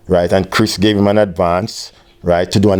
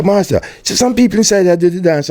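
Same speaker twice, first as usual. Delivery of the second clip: excited call; 8 semitones higher than usual. Retold or imitated. Calling to Master in traffic.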